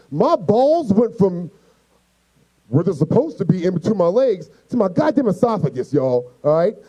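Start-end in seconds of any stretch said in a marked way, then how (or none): none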